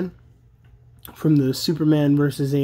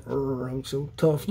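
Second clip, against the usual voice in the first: imitating tough Raph; 7 dB quieter than usual. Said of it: "Raph was always so New York".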